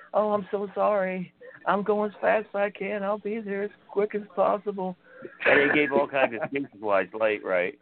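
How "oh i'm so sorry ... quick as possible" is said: high-pitched, apologetic pleading; mock panicked apology, pitched up; imitated freaking out. Late colleague apologizing to prank caller, imitated freak-out. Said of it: "he's freaking out and everything".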